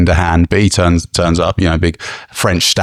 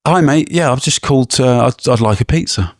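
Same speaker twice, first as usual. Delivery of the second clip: customer ordering; 8 semitones higher than usual. Pretend. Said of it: "I'll play the pizza".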